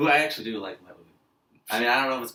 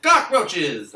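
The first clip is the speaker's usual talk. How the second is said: loud, higher New York caricature; mock New York accent; 5 semitones higher, 7 dB louder than usual. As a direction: shout it as a joke New Yorker. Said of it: "New Yorkers don't say that".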